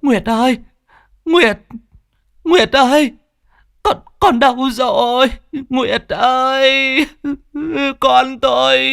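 very high, loud desperate wail; mother wailing for her drowned daughter. A narrator's reading. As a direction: cry out for a lost daughter. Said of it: "một người đàn bà đang gào khóc".

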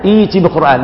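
ائت بقرآن read as إيتِ بقرآن; hamza changed to ya. Shown as a demonstration. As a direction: make the hamza of ائت a long i. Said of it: "تبدأ الهمزة ياء".

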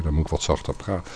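normal talking voice

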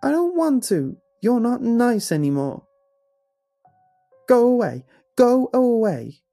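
put-on high, loud screeching child. A narrator's reading. Tearful son drives off the mirror figure, screeching. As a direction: screech it like a distraught small boy. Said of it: "he began to screech".

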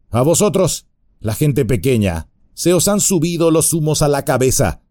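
bar their way with a sneer; burly bandit voice, taunting; menacing bandit. Acted. Bandit threatens hobbits.

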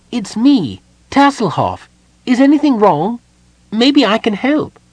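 very high, loud little character voice; calling kender. A narrator's reading. Tass offers help to his weeping friend, calling out. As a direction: pipe up high and loud through a door. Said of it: "he called out".

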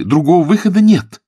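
high, loud, resolute declaration; decisive. A narrator's reading. Billy insists on driving for the police, resolute. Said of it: "решительно заявила Билли".

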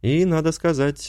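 usual speaking voice

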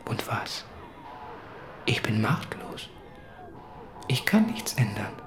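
soft, breathy whisper of helplessness; hushed resignation; resigned whisper. Acted. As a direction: whisper it, defeated. Resigned confession.

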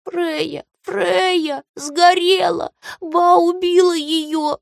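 put-on high, slow, tearful child's wail; crying child. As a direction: sob it out like a frightened child. Child cries grandma killed Freya.

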